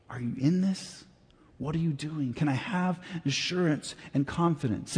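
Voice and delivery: soft, pleading whisper-like plea to God; hushed prayer. Retold or imitated. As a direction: quietly ask God for assurance.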